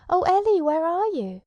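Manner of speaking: loud, high desperate call; crying out. Acted. Tom calling out for lost Ellie, loud high cry. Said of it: "And Tom cried".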